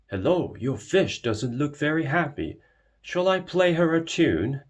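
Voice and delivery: big friendly bull offering music; hearty Mister Bull. Acted.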